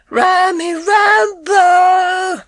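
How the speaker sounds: put-on high, slow sing-song call of an old lady; imitating Mrs Bloom. Boy practising the cat call, high and drawn out. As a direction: stretch out the call like she does. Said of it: "imitating her accent, her tone of voice".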